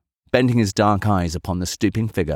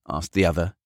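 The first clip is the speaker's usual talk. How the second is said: ordinary delivery, nothing marked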